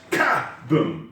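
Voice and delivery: slow "ka-boom", all the force on the first syllable; stress on KA. Shown as a demonstration. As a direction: slam the "ka" in "ka-boom", pause, then "boom". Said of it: "punch forward hard and then do this".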